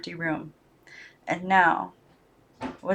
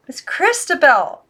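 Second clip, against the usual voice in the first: higher and louder, slow urgent name; alarmed cry; 7 semitones higher, 6 dB louder than usual. Acted. Girl cries out to the lifeless doll.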